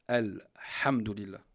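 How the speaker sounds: "Alhamdulillah" slow, the first L distinct before the h; sukun shown. Shown as a demonstration. Teacher showing the sukun on lam, slow and distinct. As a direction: mark the L of "Al", then say "hamdulillah". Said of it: "Il faut montrer cette soukoun".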